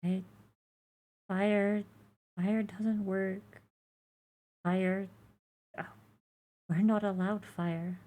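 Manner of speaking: put-on high, soft, faint baby creature; small high creature voice. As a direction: plead quietly like a fragile hatchling. Egg creature voice continues.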